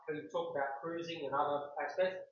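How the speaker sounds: ordinary delivery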